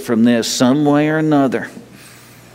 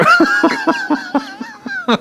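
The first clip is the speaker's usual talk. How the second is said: a sudden high laugh at his own story; high burst of laughter. Real, not acted.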